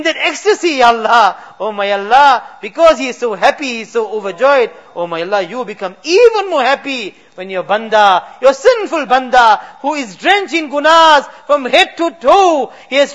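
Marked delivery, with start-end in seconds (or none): none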